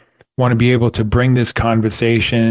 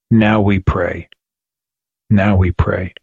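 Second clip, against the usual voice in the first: low, slow and grave, with pauses; calm, solemn answer; 4 semitones lower, slower than usual. Retold or imitated. Prosecutor of God answering a panicked fellow prisoner, calm and solemn.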